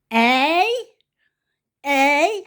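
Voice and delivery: loud, high, rising "Eh?"; hammed-up repeated "Eh?"; deaf woman's "Eh?". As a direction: shout it like someone hard of hearing. Re-enacting the deaf woman's catchphrase.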